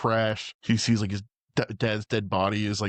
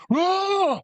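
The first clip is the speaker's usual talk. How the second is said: mock scream; 4 dB louder than usual. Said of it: "he's like".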